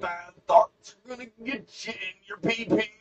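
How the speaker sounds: very high, hammed-up warning; squealed mock admonishment; Dave. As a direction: keep up the silly high scolding impression. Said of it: "Dave would be like".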